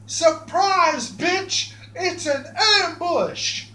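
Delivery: spring the trap with a crowing yell; triumphant ambush cry. Acted. Springing the ambush on the visitors, crowing triumphantly.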